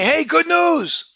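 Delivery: high-pitched, slow, drawn-out good news; thrilled, stretched-out reveal; exciting announcement. Shown as a demonstration. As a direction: punch it out with big excitement. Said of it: "make it exciting".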